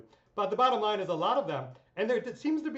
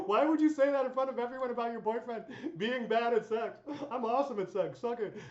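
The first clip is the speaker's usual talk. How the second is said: higher, laughing mock taunt; 6 semitones higher than usual. Own retort, laughing.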